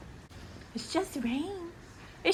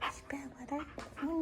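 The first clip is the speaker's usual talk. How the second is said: whispering; 8 semitones lower, 18 dB quieter, faster than usual. Acted. Asking dog about weather.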